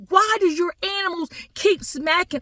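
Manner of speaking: high-pitched outraged question; livid family. Acted. Family confronting zoo over the animals, livid and high. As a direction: demand an answer in high mock fury. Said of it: "They were livid. They were like".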